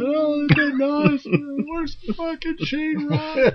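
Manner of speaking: high, squeaky mimicking of the girl; high mock voice. Friend mimicking the girl's punchline on the phone. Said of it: "Listen, go".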